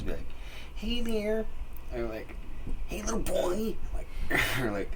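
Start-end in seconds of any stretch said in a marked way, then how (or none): 0.7-1.5 high-pitched mock holler; hollering neighbor imitation. Strange neighbor hailing kids in the street, high mock holler. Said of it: "he would holler at us". 2.9-3.8 high, put-on calling voice; hollering neighbor imitation. Strange neighbor hollering at a little boy, high mock call. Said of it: "he would holler at us".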